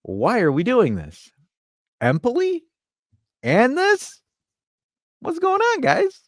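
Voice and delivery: lightly voiced boss demanding answers; high-pitched mock disbelief; exasperated boss. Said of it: "You go in here and you say".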